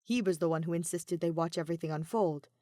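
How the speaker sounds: ordinary talk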